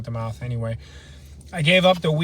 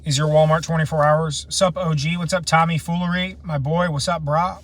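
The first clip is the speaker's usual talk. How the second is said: ordinary talk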